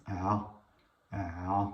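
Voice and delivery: "a" from inside the throat, repeated slowly; Arabic ayn sound. Shown as a demonstration. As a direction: demonstrate "a" as a long throaty Arabic vowel. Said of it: "Ça se prononce de l'intérieur". (« a » venu de l'intérieur de la gorge, répété lentement)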